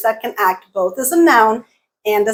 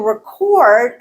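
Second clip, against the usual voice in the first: "record" as re-CORD, strong second vowel; second-syllable stress; 3 dB louder than usual. Shown as a demonstration. Teacher changing noun to verb, second-syllable stress. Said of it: "now that is our stressed syllable".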